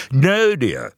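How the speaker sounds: high posh English lady voice; plummy English accent. Retold or imitated. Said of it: "in her plummy English accent".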